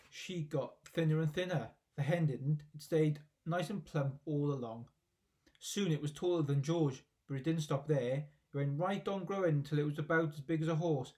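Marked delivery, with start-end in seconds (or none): none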